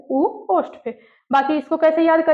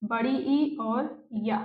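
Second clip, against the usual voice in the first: slow recital; 7 dB quieter, much slower than usual. Shown as a demonstration. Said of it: "बस इसी में याद कर लो".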